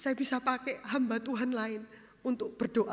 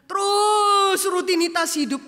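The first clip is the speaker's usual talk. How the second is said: slow, louder and higher proclamation; preaching emphasis; 7 semitones higher, 10 dB louder than usual. Acted. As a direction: hammer the word routine home to the church. Preacher stresses life's routine.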